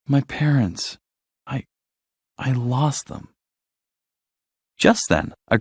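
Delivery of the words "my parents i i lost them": softer, halting and broken; mild, quiet sorrow with halting pauses; sad. A narrator's reading. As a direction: falter through it, quietly grieving. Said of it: "Dory said sadly".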